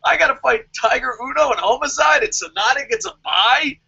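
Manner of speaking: high, baffled mock outrage; imitating Samoa Joe. As Samoa Joe complaining about the bye, high and baffled. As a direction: sound stunned and cheated. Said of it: "you're just like".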